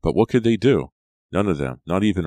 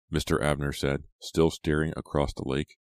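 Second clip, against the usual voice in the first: ordinary delivery, nothing marked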